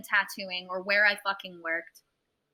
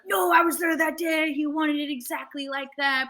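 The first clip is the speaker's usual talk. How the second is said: higher, louder mock protest; defensive protest; 7 semitones higher, 4 dB louder than usual. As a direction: imitate a guy jumping in to object. Other guy protesting, retold.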